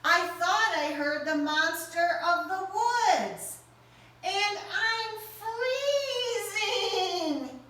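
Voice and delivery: high, anxious little mouse; Mouse's voice. Acted. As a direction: squeak it out, shivering and scared.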